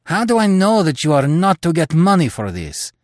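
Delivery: angry accusation. Acted.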